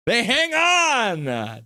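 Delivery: high, drawn-out victory cry; excited winning call. Real, not acted. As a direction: stretch it out high as the win lands. Repeats the winning call, bigger.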